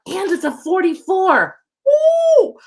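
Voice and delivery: high, loud, building to a whoop; real excited outburst; thrilled announcement. Real, not acted. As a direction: announce it and break into a cheer. Thrilled at the card's number.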